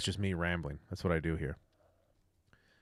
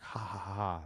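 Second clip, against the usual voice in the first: gloating laugh; 7 dB quieter than usual. Pretend.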